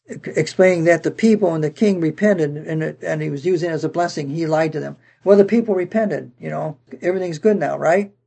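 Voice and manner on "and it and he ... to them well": ordinary delivery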